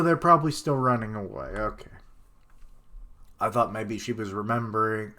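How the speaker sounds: ordinary talk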